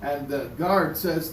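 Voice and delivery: ordinary talk, nothing marked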